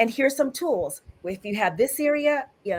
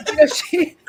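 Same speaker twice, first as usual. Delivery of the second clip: laughing through tears; 5 semitones higher, 6 dB louder than usual. Real, not acted. Laughing tearfully about the scene.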